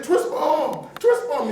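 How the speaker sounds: high-pitched urgent plea, acted out; mock-desperate, high and insistent. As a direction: re-enact begging for help with a hurt shoulder. Hurt onstage, pleading for help.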